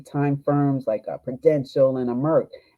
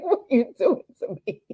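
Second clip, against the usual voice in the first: laughing protest; 11 semitones higher than usual. Retold or imitated. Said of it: "I was like".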